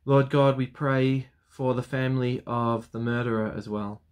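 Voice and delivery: normal talking voice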